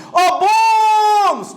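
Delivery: high, stretched call on bônus; long drawn-out call. Shown as a demonstration. Teacher hollering an example word to the class, stretched call. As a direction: holler bônus, holding the stressed vowel.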